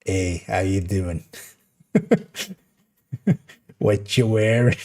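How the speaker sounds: slow, low creaky come-on; vocal fry. Shown as a demonstration. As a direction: lay the vocal fry on thick, slowly. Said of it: "it's kinda that voice".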